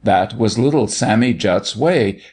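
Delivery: ordinary delivery, nothing marked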